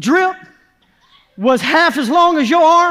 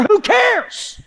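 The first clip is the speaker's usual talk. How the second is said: emphatic dismissal; 8 semitones higher than usual. Acted. Preacher imagines weeping before a skeptical coworker, defiant. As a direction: pitch leaps high in defiance.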